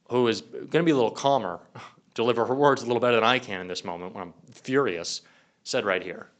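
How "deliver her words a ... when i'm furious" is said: ordinary talk, nothing marked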